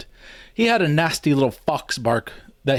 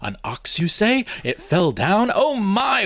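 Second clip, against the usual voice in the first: higher, sarcastic feigned wonder; mock astonishment; 9 semitones higher, slower than usual. A narrator's reading. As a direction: overplay the amazement to tease him. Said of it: "I gasped in feigned astonishment".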